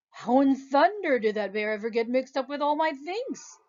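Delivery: a hint of a wondering man; mildly puzzled man talking to himself; lightly voiced puzzled artist. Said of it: "the artist wondered".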